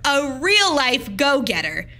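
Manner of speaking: plain reading voice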